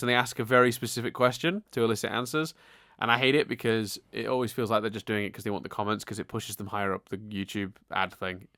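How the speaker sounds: ordinary delivery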